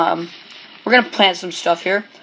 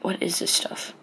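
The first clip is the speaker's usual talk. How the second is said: whispered puzzled question; 10 dB quieter than usual. Real, not acted. Gamer whispering to himself over strange items. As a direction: breathe it, puzzled.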